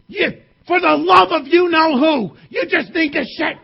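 exasperated mom. Acted. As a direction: snap at the kids like a tired mom. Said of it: "And she's saying this".

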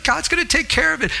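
the virtuous woman unbothered by trouble; carefree woman. Retold or imitated. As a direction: toss it off without worry. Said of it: "She's like".

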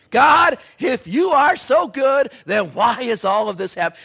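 high, loud, slow protest; a churchgoer shouting at God; shouted complaint to God. Acted. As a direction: cry out the grievance. Congregation's voice complaining.

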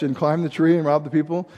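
ordinary talk, nothing marked

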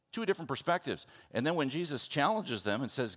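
usual speaking voice

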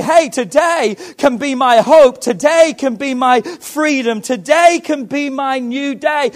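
high, loud, triumphant proclamation; shouted declaration. Acted. Preacher rallying the church to rise, loud and triumphant.

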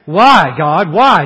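put-on loud, protesting why, like a spoiled child. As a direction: demand an answer like a sulky child. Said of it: "Just like a spoiled child".

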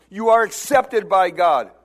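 ordinary delivery

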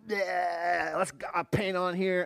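put-on high, loud excited kid voice. Dad imitating kids eager to paint, loud and high. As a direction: kids thrilled to start painting.

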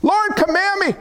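high urgent voice of Peter; Peter's plea. Acted. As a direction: call out to the Lord from the boat. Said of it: "he said to him".

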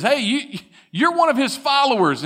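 put-on high, pointed accusation from a girl; accusing servant girl.

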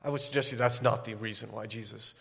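ordinary delivery

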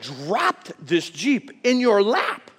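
drawn out slowly, each word weighed; slow emphatic. Retold or imitated. Father imagining talking to his son, slow and emphatic. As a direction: spell it out for the dense son. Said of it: "You'd be like".

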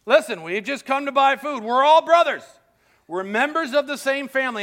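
high, loud frantic denials; panicked pleading. Retold or imitated. Brothers panicking as they deny being spies, high and loud. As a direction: plead fast like scared men caught out. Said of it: "And the brothers are like".

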